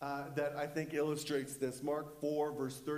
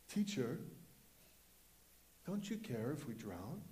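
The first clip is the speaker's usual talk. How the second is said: soft, low, mild question; deliberately understated plea; 4 semitones lower, 6 dB quieter than usual. Shown as a demonstration. Disciples waking Jesus in the storm, deliberately mild and low. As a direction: read the panicked plea far too calmly. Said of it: "Do you think that's how they said it?".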